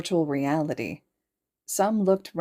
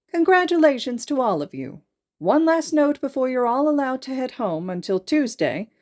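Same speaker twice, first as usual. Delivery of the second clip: principal dismissing the students after the test, formal and raised; principal's address; 6 semitones higher than usual. Acted.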